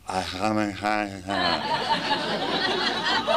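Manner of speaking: mock drunk giggle, barely able to talk; drunk giggling. Drunk friend Neil can't drive, only giggling. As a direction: giggle helplessly like a wasted friend. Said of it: "He literally can't, he can't even talk".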